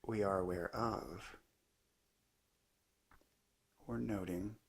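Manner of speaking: unhurried meditation teacher, long pauses between phrases; calm, measured guidance in short spaced phrases; guided meditation. Acted. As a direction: let each phrase settle into a long silence. Leads a guided meditation talk.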